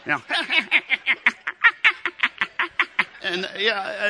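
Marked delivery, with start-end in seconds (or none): 0.3-3.2 shrill mock toy laugh, way up high; squealing toy-doll giggle; high giggle imitating Elmo. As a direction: cackle like a broken Tickle Me Elmo. Mimics Elmo's laugh.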